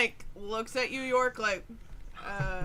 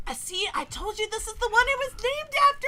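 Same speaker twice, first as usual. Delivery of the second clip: much higher, rushing, breathless gush; gushing goblin fan; 11 semitones higher than usual. Acted. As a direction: squeal like a starstruck fan. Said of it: "he's so excited he can't even talk straight".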